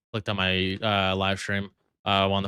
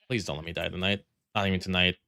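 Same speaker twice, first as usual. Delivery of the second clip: normal talking voice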